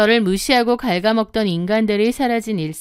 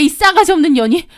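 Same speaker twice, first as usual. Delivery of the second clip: high, loud furious insult; angry outburst; 7 semitones higher, 5 dB louder, faster than usual. Acted. Narrator exploding at sister-in-law on the phone, furious. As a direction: spit the insult out. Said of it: "저도 완전 폭발했습니다".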